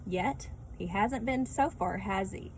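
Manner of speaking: usual speaking voice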